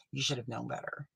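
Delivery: ordinary talk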